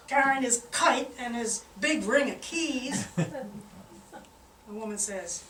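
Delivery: normal talking voice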